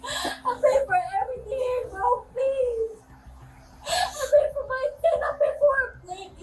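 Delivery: tearful desperate pleading; crying. Real, not acted. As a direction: beg through sobs. Pleading in tears after arrest.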